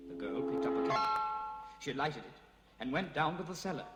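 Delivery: plain reading voice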